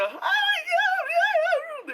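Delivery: high, squealing fan excitement; mock giggly fan squeal; giggly fangirl gush. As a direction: gush like a starstruck teen fan. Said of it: "they were like a giggly mess".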